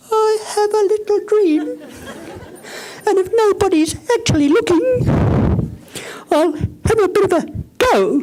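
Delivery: squeaky high timid voice, half-hearted; mock a meek dreamer in a tiny high voice; timid little voice. Shown as a demonstration.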